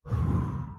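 long slow breath out; calming exhale. Shown as a demonstration. Telling himself to take a breath, calming exhale. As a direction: let out a demonstrative deep breath. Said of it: "Take a breath".

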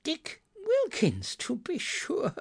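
put-on very high, slow, delighted old voice; fond recognition. A narrator's reading. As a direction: recognise an old friend with emotion. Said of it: "said Scrooge to the ghost".

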